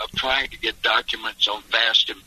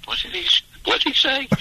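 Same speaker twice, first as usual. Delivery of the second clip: confused courtroom; faster than usual. Retold or imitated. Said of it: "everybody in the courtroom ... would be going".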